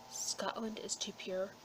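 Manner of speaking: ordinary delivery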